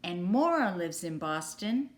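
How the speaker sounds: usual speaking voice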